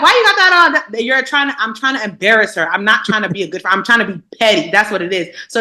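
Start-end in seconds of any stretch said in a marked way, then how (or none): 0.0-0.9 mock shout. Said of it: "I'm shouting like".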